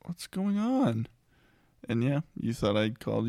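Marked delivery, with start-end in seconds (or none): 0.1-1.1 slow, high, baffled "what's going on"; bewildered husband. Retold or imitated. Husband coming back to find his wife crying, baffled and slow. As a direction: act lost and baffled, drawing it out. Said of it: "I'm like".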